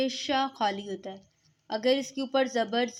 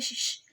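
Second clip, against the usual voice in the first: spread shh; 4 dB quieter than usual. Shown as a demonstration. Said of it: "इस तरह".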